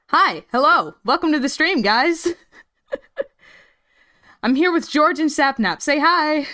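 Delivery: bright, upbeat stream intro; streamer greeting voice. Acted.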